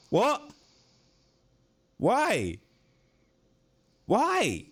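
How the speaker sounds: high squeaks of disbelief; very high stunned yelps; shocked cries. Real, not acted. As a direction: squeal each word in shock. Shocked reaction.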